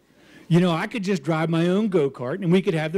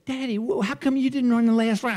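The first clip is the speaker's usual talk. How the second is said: daughter's voice; 5 semitones higher than usual. Retold or imitated. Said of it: "and she says".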